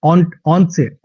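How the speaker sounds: normal talking voice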